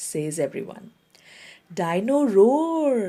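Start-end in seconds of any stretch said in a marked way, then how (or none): 1.8-3.0 slow, higher and louder thrilled cry; excitedly. Acted. Little George thrilled by his new toy, excited and drawn out. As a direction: savour the name in delight. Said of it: "says George excitedly".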